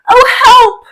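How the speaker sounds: high shouted plea; cry for help. Acted. Cassio calling out for help, loud. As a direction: yell it out for help.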